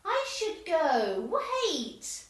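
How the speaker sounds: loud, high, pleading wait; desperate calling. Acted. Jane loudly begs the others to wait for her. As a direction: call after them, slow and loud.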